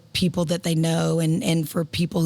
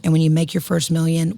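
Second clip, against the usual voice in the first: ordinary talk, nothing marked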